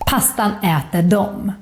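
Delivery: final "dem" said as "dom"; spoken form. Shown as a demonstration. Teacher giving an example, "dem" pronounced "dom". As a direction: end "Pastan äter dem" on an o vowel, "dom". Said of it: "dom" to this word as well".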